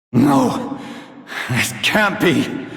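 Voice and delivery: high, strained voice refusing the truth; cracking high-pitched denial as everything falls apart; despairing disbelief. Acted. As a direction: deny it in rising panic. Father's plan collapsing.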